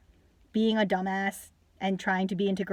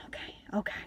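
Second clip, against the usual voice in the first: barely voiced, much softer aside; weary muttering; 11 dB quieter than usual. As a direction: mutter it under your breath, fed up. Weary reaction to the stereotype.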